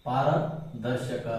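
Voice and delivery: ಪಾರದರ್ಶಕ drawn out very slowly, syllable by syllable; slow dictation. Shown as a demonstration. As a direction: dictate ಪಾರದರ್ಶಕ so students can write it. Teacher dictating term to write.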